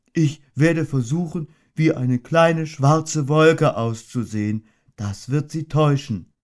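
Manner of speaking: a gentle, ponderous little bear thinking aloud; Winnie the Pooh voice. A narrator's reading. Pooh announces his disguise.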